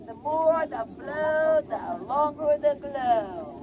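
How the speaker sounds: loud, slow showman's chant; drawn-out slogan. Acted. Hotel man pitching for money in the hat, drawn-out slogan. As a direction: stretch the rhyme out loudly for the crowd.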